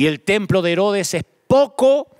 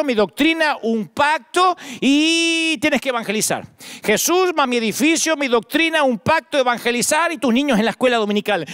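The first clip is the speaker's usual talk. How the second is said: mildly hammed-up, higher-pitched piling-on of rules; mock list of demands; 6 semitones higher than usual. Preacher mimics churches adding requirements to Jesus, mock insistent listing. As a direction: recite an ever-growing list, stretching the links.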